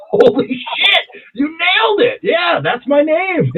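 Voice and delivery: very high, drawn-out whoop of disbelief; ecstatic amazement. Retold or imitated. Customer retells telling the bartender she nailed it, ecstatic. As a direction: stretch it out high, thrilled she got it.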